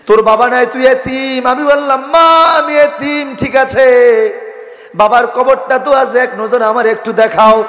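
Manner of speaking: impassioned preaching. Acted. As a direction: cry out the orphan's exchange with his mother.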